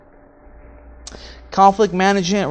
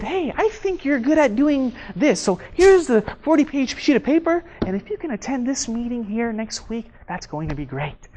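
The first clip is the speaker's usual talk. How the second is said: high, sugary, smiling voice; fake nice; 4 semitones higher, 5 dB quieter than usual. Manager handing over work, mock-sweet and smiling. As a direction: pile on work with a smile. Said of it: "I told him this in a nice way. I smiled".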